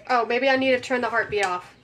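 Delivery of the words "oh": ordinary delivery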